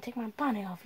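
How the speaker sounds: normal talking voice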